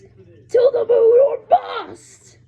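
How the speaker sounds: high, loud cheering; shouting the big finish; excited shout. Acted. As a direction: yell it like a battle cry. Climactic line shouted.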